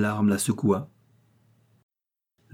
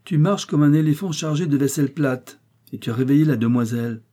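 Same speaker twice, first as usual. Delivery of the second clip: high, loud, fast fussing scold; old lady scolding the servant; 5 semitones higher, 4 dB louder than usual. A narrator's reading. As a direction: nag the clumsy maid.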